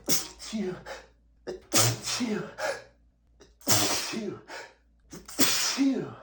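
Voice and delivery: a fit of loud sneezes; sneezing. Real, not acted. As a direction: sneeze hard several times in a row. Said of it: "I've been sneezing nonstop".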